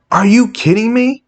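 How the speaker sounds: high, exasperated outburst; incredulous outrage. Real, not acted. Fan answering Hawkeye's excuse, incredulous. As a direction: pitch it high in sheer disbelief.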